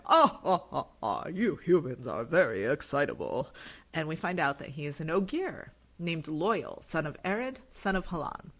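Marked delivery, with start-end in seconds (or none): 0.1-3.5 chuckling creature. Retold or imitated. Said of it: "this creature is like".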